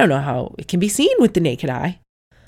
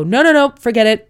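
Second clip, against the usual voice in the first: high, loud "no, no, no" like a woman in labour giving up; imitating a woman in labour who wants to quit, loud and high; mock panicked refusal; 6 semitones higher, 8 dB louder than usual. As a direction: act out a labouring woman trying to bail out. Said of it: "women have this transition where they go".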